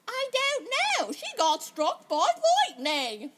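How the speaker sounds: put-on high, slow, drawn-out English accent; exaggerated English accent. Imitating a film extra after a lightning strike, hammed-up English accent. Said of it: "suddenly had an English accent".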